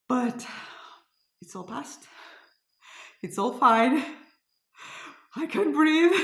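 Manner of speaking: real release of tension, pitch climbing high; broken into short bursts with long pauses; emotional relief. Real, not acted. Overcome with relief after the scare.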